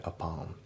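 "アパン" as one word, a-pon; linked words. Shown as a demonstration. Teacher showing learners linking in up on, joined. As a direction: carry the P over into on, "アパン". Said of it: "アップオンのところもリンキングで".

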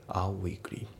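"Our weekly" as "out weekly" without the T; dropped T. Shown as a demonstration. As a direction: drop the T of out before weekly. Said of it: "アウトのTが抜けて". (Tが抜けた「Our weekly」)

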